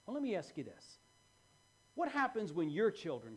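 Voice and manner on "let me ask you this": ordinary delivery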